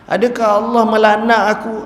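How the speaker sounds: high, loud fearful question; the weeping man fearfully asks Umar if he is cursed; anxious plea. Acted. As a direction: ask in dread of being cursed. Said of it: "Lalu dia kata".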